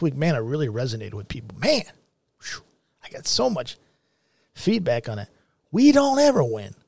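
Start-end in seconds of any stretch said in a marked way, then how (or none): 5.7-6.7 raised protest. Real, not acted. Rants about losing.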